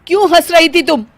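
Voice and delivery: loud, high demand; husband demanding why she laughed; angry demand. Acted. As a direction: confront her loudly.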